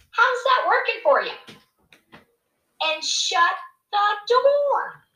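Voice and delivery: high-pitched imitation of a brusque mother; mock Liverpudlian mom, high and drawn out at the end; tough-love Liverpudlian mom. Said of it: "in her Liverpudlian way".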